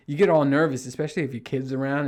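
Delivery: ordinary talk, nothing marked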